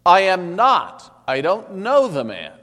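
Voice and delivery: loud, high, slow protest under oath; vehement denial. A narrator's reading. Peter denying to the crowd, hot and loud. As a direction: deny it with all his force. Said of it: "he denied it with an oath".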